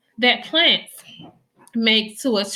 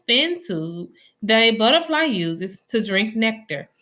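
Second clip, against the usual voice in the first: ordinary delivery, nothing marked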